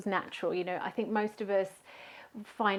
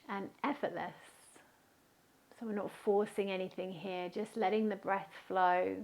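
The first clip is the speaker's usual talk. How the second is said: guided meditation. Real, not acted. Yoga teacher guiding a festival class to settle, calm guided meditation. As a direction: guide them gently into stillness, leaving space between phrases.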